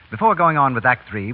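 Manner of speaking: normal talking voice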